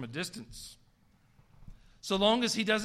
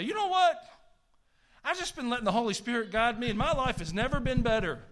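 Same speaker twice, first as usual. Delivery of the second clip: high, loud burst of excitement; thrilled churchgoer sharing good news; excited testimony; 5 semitones higher, 4 dB louder than usual. Retold or imitated. Acts out a delighted testifying member.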